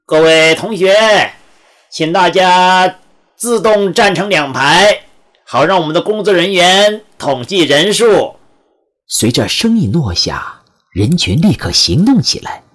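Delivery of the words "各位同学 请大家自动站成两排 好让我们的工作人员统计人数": high, slow, projected call to a crowd; loud announcement. A narrator's reading. Man in a suit addressing new students in the hall, calling out loudly. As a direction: call out slowly, raised and drawn out. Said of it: "高声说".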